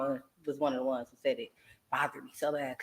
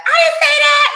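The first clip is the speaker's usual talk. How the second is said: much higher, loud denial; denying shout; 12 dB louder, slower than usual. Retold or imitated. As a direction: shout the denial in mock outrage. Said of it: "And you like".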